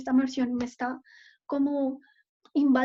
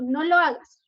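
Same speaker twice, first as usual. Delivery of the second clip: firm no; 3 semitones higher, 5 dB louder than usual. Shown as a demonstration. Madre prohíbe al niño tirar cosas.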